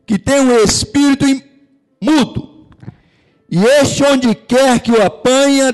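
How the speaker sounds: high, loud, fervent sermon voice; full-force preaching. Acted. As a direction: proclaim the Gospel passage to the whole church. Opening passage preached at full force. (pregação inflamada, aguda e forte)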